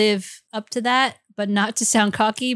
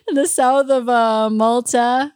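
put-on playful Chinese accent, drawn out; slow, teasing Chinese accent; 5 semitones higher, 6 dB louder than usual. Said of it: "Is that your Chinese accent again?".

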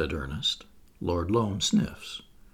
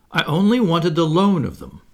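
louder, proud and offended; a proud spirit misunderstood; haughty; 7 semitones higher, 8 dB louder than usual. Acted. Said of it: "rising haughtily".

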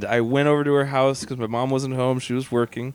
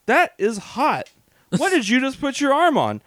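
brain alarm; 9 semitones higher than usual. Retold or imitated. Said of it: "lights my brain up going".

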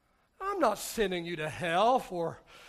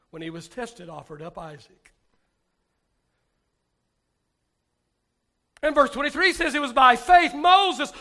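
preaching scripture; 6 semitones higher, 12 dB louder than usual. Acted. Reading scripture forcefully.

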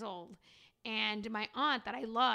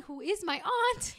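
disbelief; 8 semitones higher, 6 dB louder, slower than usual. Retold or imitated. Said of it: "I'm like".

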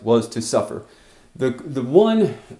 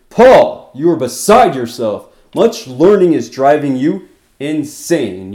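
bellowed, booming out the name Paul; loud voice; 8 dB louder than usual. Acted. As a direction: shout it at Paul across the courtroom. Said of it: "you've got to say it with a loud voice".

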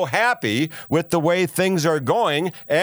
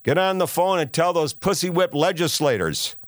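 ordinary talk, nothing marked

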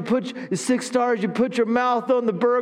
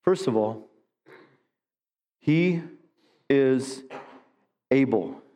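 sermon preaching; 5 semitones lower than usual. Acted. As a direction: preach it, leading the church in response. Preaching, call and response.

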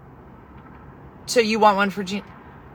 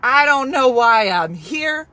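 exasperated; 6 semitones higher, 7 dB louder, slower than usual. Real, not acted. Exasperated opening on live.

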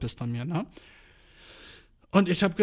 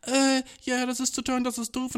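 high, whiny complaining customer; a customer griping about prices, high-pitched; whiny Karen; 8 semitones higher than usual. Retold or imitated. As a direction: nag like an entitled customer. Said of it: "Karens, die sich beschweren und sagen".

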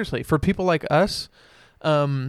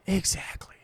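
soft, voiceless echo; whispered agreement; 5 semitones higher, 8 dB quieter than usual. Real, not acted. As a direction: drop to a whisper. Hushed repeat of agreement.

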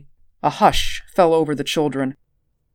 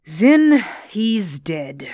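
slow grim voice; 5 semitones higher, much slower than usual. Acted. Reinhardt hears no sign of life.

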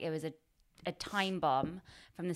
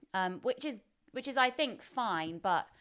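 usual speaking voice

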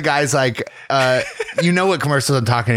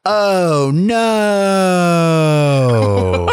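hammed-up groaning oh no, pitched up then drawn out; mock despair; 5 semitones higher, 4 dB louder than usual. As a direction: ad victim moaning at bad news, mock despair. Despair in ad reenactment.

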